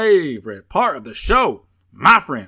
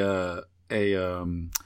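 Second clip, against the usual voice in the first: usual speaking voice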